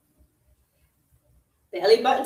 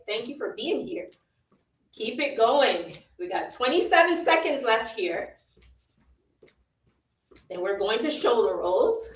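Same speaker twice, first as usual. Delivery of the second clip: ordinary talk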